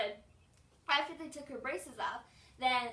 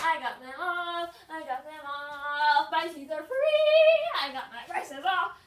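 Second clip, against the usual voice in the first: excited; 4 semitones higher, 11 dB louder than usual. Real, not acted. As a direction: sing out the freedom. Celebrating braces off.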